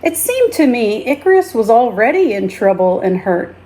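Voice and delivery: ordinary delivery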